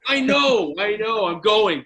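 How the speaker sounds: high, put-upon protest; exasperated compliance. Retold or imitated. Goalie finally giving in to trainer and coach, exasperated. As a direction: give in loudly and grudgingly. Retold grudging answer to being sent in.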